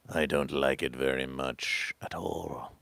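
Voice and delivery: slow, low whisper; hushed mock rejection. Same rejection repeated in a whisper.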